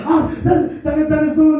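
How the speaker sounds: very high, loud mock panic; hammed-up frantic jabbering. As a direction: imitate a frantic babbler, pitch shooting up. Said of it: "he's frantically speaking".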